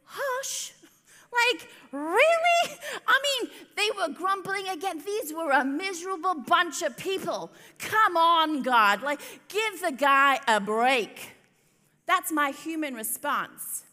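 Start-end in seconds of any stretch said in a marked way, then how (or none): 0.0-11.4 much higher, louder, laughing protest; indignant outburst. Real, not acted. Preacher defending Moses against God, laughing indignant protest. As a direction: plead for Moses half laughing, half crying. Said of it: "My personal human reaction is".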